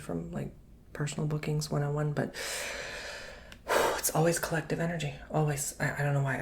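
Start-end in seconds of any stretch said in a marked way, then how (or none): 2.4-3.9 long, airy exhale; drained sigh. Real, not acted. Reader explaining collective energy drains them, long drained sigh. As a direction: blow the breath out wearily.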